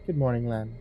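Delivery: purred southern greeting, softly; soft-spoken southern belle; soft southern accent. A narrator's reading. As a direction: purr it in a gentle southern accent. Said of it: "Speaking in a soft southern accent".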